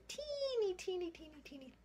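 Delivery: put-on high tiny voice on teeny, teeny; squeaky teeny. A narrator's reading. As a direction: make the word as tiny as the branch. Tiny branch described.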